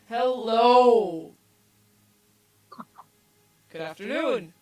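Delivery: booming hearty dwarf hello; loud dwarf greeting. Acted. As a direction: greet them like a big loud barkeep. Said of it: "turns around and goes".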